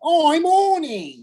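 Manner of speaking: very high mock Australian woman; Australian accent. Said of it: "In Australian?".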